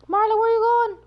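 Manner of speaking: high, loud call to someone leaving. Real, not acted. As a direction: shout after Marlon as he walks off. Calling out to someone nearby.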